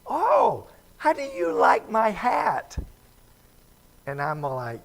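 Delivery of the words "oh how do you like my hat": much higher, louder mock lady fishing for praise; proud church lady. Church lady showing off her ugly hat, high and loud. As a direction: squeal it like a delighted lady. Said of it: "she said".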